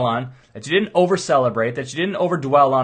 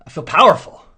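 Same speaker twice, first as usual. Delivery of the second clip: proud declaration; 2 semitones higher, 9 dB louder than usual. Retold or imitated. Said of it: "imagined proud client".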